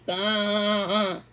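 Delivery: a short low bleat; lightly voiced sheep's bah. A narrator's reading.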